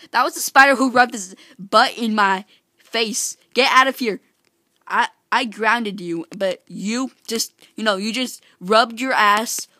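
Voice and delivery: loud mock-outraged telling-off; indignant scolding. Player to spider in his face, loud indignant scolding. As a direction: berate the creature at full volume.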